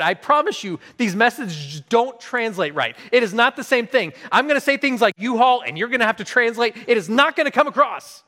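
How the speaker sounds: high, emphatic objection; exasperated pleading with a buddy; insistent protest. Retold or imitated. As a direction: argue back hard, pitch climbing. Acted protest against friend's plan.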